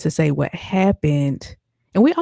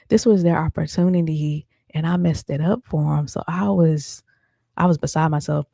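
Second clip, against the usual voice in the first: ordinary talk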